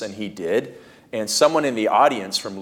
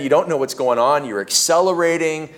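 normal talking voice

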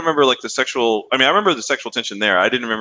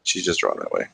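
very low, soft, sultry voice; deep, hushed femme fatale; sultry cartoon siren; 5 dB quieter than usual. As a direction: drop deep and purr the quote. Quoting Jessica Rabbit's famous line.

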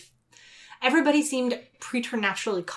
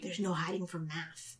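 hushed, slow and ominous; chilling hush; 3 semitones lower, 13 dB quieter, slower than usual. Retold or imitated. The council telling the crew there's no escape, in a slow ominous hush. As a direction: breathe it slowly like a threat. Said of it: "a little bit chilling".

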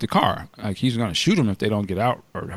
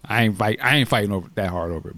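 ordinary talk

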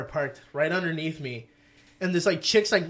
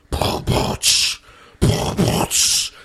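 imitated beat; 5 dB louder, slower than usual. Imitates loud music from car.

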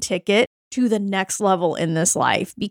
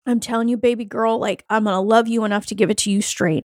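ordinary talk

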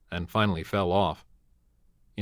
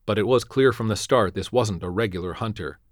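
plain reading voice

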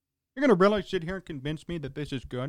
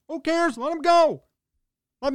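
dismissive shout; 8 semitones higher, 4 dB louder than usual. Real, not acted. Dismissive rant.

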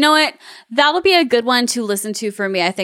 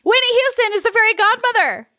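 excited; 12 semitones higher than usual. Real, not acted. Repeats it, much more excited.

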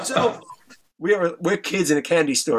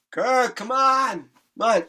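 drawn-out, higher-pitched pleading call; 5 semitones higher, slower than usual. Retold or imitated. Tipsy friend at Kirk's front door, calling out.

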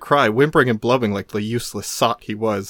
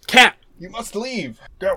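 mock stern to cat; 9 semitones higher than usual. Shooing the cat.

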